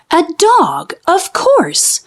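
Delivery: high "A dog, of course!"; light up with the sudden answer; sudden realization. A narrator's reading. Mole realizes the culprit.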